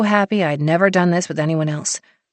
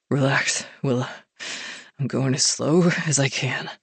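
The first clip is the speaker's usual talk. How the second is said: soothing murmur; 3 semitones lower, 4 dB quieter than usual. A narrator's reading. Hero soothing low.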